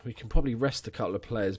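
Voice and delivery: ordinary talk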